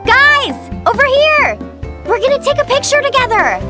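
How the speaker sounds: high, carrying call to friends; excited holler. Acted. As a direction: summon the group from a distance. Calling friends over for photo.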